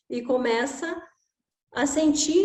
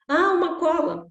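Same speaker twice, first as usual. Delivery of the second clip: put-on eager child; 6 semitones higher, 4 dB louder than usual. Child's idea.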